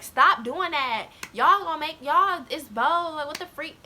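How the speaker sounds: exasperated telling-off. Retold or imitated. Girl scolds classmates to stop, exasperated. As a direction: imitate a fed-up girl scolding.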